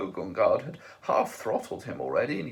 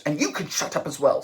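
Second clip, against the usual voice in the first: snapped; 5 semitones higher, 6 dB louder than usual. A narrator's reading. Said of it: "snapped Ford".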